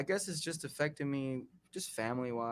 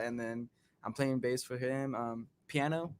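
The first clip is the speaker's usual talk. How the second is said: ordinary talk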